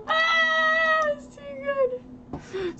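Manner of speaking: high, slow wheeze of laughter; crying with laughter. Real, not acted. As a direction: laugh until it hurts. Cry-laughing at her cat.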